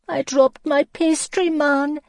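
high, loud wailing lament. Acted. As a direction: moan about the lost pastry. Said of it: "she lamented".

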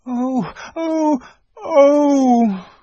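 very high anguished wail; crying out. A narrator's reading. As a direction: girls crying out in grief. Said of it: "cried the two girls".

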